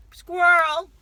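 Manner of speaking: sudden loud, high blurt; mock distraction.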